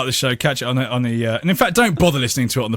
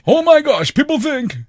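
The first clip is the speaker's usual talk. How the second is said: mocking echo; 11 semitones higher, 4 dB louder than usual. Mocking co-host's phrase.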